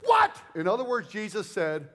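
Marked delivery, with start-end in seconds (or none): 0.0-0.3 high, loud shocked "What?"; shocked crowd. Retold or imitated. Crowd hearing Jesus's claim, high loud shock. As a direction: burst out in disbelief. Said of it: "And they said".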